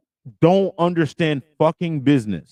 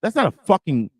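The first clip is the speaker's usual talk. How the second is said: normal talking voice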